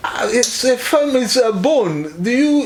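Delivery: ordinary talk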